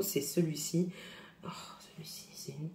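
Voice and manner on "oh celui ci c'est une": whisper. Real, not acted. Whispered aside over a product.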